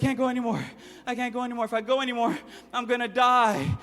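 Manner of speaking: high, dramatic complaint; whining exhausted boy.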